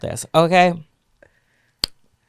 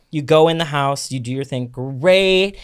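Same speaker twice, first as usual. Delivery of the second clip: ordinary talk, nothing marked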